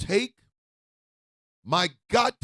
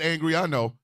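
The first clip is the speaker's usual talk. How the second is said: usual speaking voice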